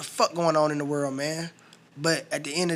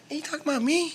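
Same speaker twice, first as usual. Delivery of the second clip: mock surprise; 11 semitones higher, 3 dB quieter than usual. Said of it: "And I'm like".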